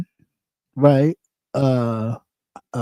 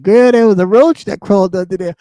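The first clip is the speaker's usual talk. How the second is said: chat voice; 4 semitones higher, 5 dB louder than usual. Retold or imitated. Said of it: "the chat was probably saying".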